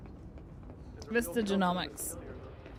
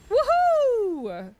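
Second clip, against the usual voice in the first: high, loud real whoop; excited cheer; 14 semitones higher, 7 dB louder than usual. Real, not acted. Streamer cheering her top seventy-five percent, loud and high. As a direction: celebrate out loud.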